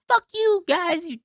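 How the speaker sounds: shrill high mock scream of an angry woman. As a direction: imitate her screeching at them. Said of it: "starts screaming at us".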